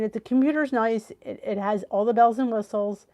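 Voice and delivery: ordinary talk, nothing marked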